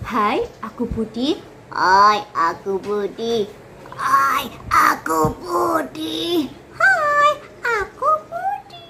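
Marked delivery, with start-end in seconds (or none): 1.7-3.5 nasal voice. Shown as a demonstration. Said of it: "Jadi ada lima ya". 4.0-6.5 put-on very slow, pressed elderly voice; old grandparent voice. Shown as a demonstration. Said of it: "Jadi ada lima ya". 6.8-8.8 put-on high squeaky small child voice; little child voice. Shown as a demonstration. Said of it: "Jadi ada lima ya".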